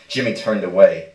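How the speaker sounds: plain reading voice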